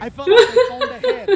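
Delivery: high and loud through laughter; laughing aside. Real, not acted. Comic laughing at his own bad luck to the crowd. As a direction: crack up mid-line.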